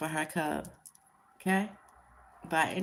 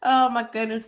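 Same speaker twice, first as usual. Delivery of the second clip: laughing; 3 semitones higher, 8 dB louder than usual. Real, not acted. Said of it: "forgive me for laughing".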